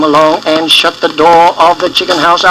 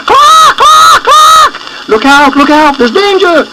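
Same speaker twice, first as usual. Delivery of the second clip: very high, loud alarmed clucking; screaming hen; 5 dB louder than usual. A narrator's reading. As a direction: shriek a warning to the chicks. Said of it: "and screamed".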